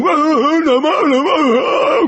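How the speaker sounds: very high mock bawling; hammed-up tantrum wail; harder-crying child. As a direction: bawl as a child rolling in tantrum. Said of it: "Dia mencoba menangis lebih keras".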